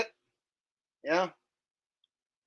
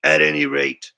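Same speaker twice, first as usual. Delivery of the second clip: Richie from Boston; 6 semitones lower than usual. Pretend. Viewer requests Richie, streamer rambles in a Boston accent. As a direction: lower put-on Boston accent, rambling conspiracy monologue.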